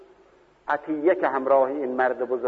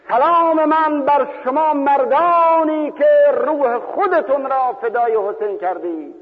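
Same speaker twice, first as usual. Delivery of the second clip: exalted greeting; 11 semitones higher, 6 dB louder than usual. Retold or imitated. Jabir greets the martyrs.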